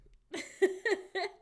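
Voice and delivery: laugh. Real, not acted.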